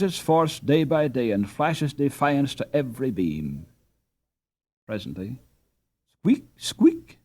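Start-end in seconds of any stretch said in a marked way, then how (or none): 6.2-7.2 squeaky skate noise, pitched much higher; high squeaking of skates on ice; high skate squeaks. A narrator's reading. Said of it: "sounded something beneath Hans's feet".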